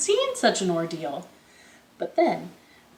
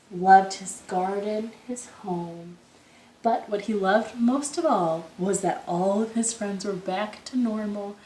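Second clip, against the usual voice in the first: ordinary delivery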